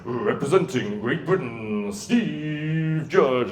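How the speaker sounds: slow, formal announcement over the music; introducing the competitor at the World Championships; official announcer. Retold or imitated. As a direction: announce the athlete like a stadium speaker. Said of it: "this time it's all very official".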